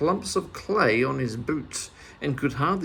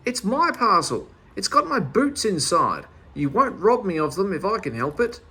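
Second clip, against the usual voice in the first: higher, louder indignant shout; shouting back; 5 semitones higher, 4 dB louder than usual. Acted. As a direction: hold the parcel up and defy him. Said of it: "shouted back".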